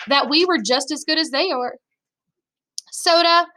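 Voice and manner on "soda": higher call to someone in another room. A narrator's reading. Younger brother calls from the bathroom, calling out. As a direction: call out to get his attention, pitched up. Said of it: "I called from the bathroom".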